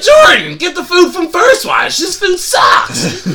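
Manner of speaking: hammed-up crazy regular shouting; mock shout. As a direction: yell it like a ranting old regular. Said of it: "He's like".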